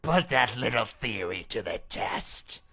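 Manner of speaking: loud, mocking dare; a loud, sneering threat from the masked princess; menacing taunt. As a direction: goad him to try it.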